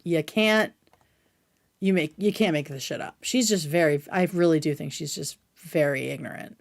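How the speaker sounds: normal talking voice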